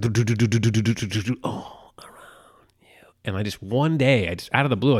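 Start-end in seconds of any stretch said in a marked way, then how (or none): 0.0-1.4 rapid doo-doo bouncing noise; imitated jingle. Podcaster mimicking a cinema commercial, bouncy jingle. 1.4-3.1 slow, soft whisper; imitated ad whisper. Podcaster mimicking a cinema commercial, slow whisper.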